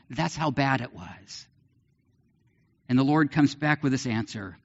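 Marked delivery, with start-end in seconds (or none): none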